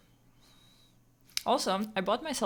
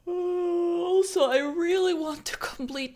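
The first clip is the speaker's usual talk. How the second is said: whiny groan; 10 semitones higher, 6 dB louder than usual. Real, not acted. Tired streamer whining.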